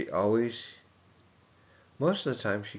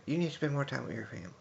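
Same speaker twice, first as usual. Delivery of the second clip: much higher, fast mock-scolding aunt voice; scolding Debbie; 7 semitones higher, much faster than usual. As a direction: rattle it off like a nagging aunt. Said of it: "Debbie, she'd be like".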